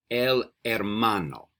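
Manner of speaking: "El hermano" slowly, with the h silent; model pronunciation. Shown as a demonstration. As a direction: say "El hermano" drawn out for learners to repeat, no h sound. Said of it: "I'm gonna say it three times".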